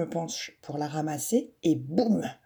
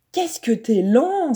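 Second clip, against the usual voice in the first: higher, slow taunting jeer; a boy jeering at a slow player; mocking exclaim; 7 semitones higher, 3 dB louder, slower than usual. A narrator's reading. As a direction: taunt him for being slow. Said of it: "s'exclame Jules".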